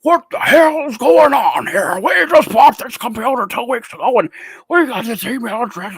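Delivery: loud, high put-on old husband voice; mock angry yelling. Old husband yelling at Microsoft support, imitated as outraged. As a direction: holler like an outraged old man, hammed-up. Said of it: "dude's yelling at me".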